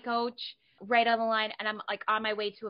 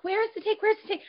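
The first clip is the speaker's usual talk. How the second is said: high, raised repeated panicked cry; mock frantic yell; 13 semitones higher, 4 dB louder than usual. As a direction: call it out in a comic panic. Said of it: "What the fuck is she screaming?".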